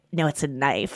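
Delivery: low, very soft correction; imitated little boy. Imitating a boy correcting his aunt, low and soft. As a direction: drop low and quiet, matter-of-fact. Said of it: "And he's like".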